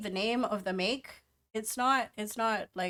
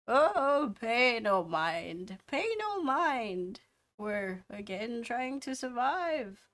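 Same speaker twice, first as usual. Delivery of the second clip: put-on old dwarf voice; 4 semitones higher, slower than usual. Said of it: "the old dwarf also pipes up".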